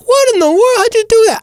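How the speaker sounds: very high falsetto disbelief; astonished. Acted. Reacting to actors switching accents, squeaky mock astonishment. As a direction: squeak it out in hammed-up amazement.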